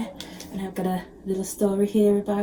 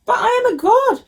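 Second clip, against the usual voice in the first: put-on boastful young god; 14 semitones higher, 5 dB louder than usual. A narrator's reading. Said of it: "said Ganesha".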